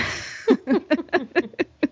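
high, light run of laughter; amused high giggle; real laugh. Real, not acted. Laughs at her teenage self.

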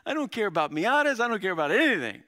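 high, loud paraphrase of the psalmist; passionate. Acted. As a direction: throw it out with fervent abandon. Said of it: "Look at that passion".

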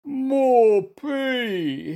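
high, loud, feigned suffering; pitiful moan. A narrator's reading. Bear pretends to be ill, pitiful high moan. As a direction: wail pitifully as a poorly bear.

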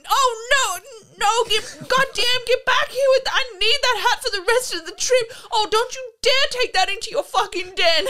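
high, loud mock tantrum; imitating angry yelling. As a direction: yell like a furious man losing his hat to a fox. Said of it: "he's just yelling about this fox".

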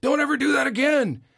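high, loud family scolding; scolding shout. Retold or imitated. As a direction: shout it at the kid who scared everyone. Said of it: "everybody turns around and goes".

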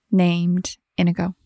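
ordinary talk, nothing marked

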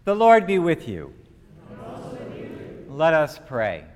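ordinary talk

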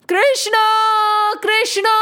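high calling shout; shouting a name. Acted. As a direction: call his name out to the whole village. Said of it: "Every house started shouting".

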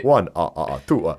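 loud, hammed-up counting with a vampire cackle. Likening the therapist to the Count, in mock vampire voice. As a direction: count out loud like a cartoon vampire, laughing in character. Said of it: "it's like the Count from Count Dra".

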